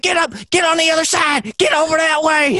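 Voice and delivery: high-pitched mock yelling at a tiger; imitated yelled instructions. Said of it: "he's, like, yelling instructions at the tiger".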